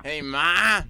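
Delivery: loud Bronx call to mom; Bronx accent. Retold or imitated. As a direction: yell \"Hey, Ma\" Bronx style. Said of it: "That's what a girl from the Bronx says".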